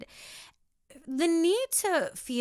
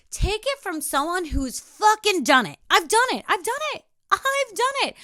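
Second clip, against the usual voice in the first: rising louder and higher with each repeat; building emphatic insistence; 9 semitones higher, 7 dB louder than usual. Real, not acted. Telling viewers she's been there, building to a near shout. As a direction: hammer it home, building each time. Emphatic self-help rant, escalating.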